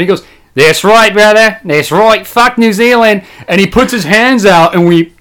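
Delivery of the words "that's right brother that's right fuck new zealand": higher, hearty imitated Australian; Australian accent. Customer bonds with worker over New Zealand, hearty Australian accent. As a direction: exclaim in delight as an Australian. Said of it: "starts bursting out laughing".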